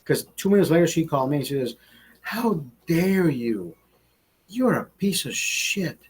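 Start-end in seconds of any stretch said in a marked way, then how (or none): 2.2-6.0 slow, drawn-out imitation of an outraged woman; hammed-up, slowed indignant girlfriend; mock angry girlfriend. Girlfriend's furious phone call retold.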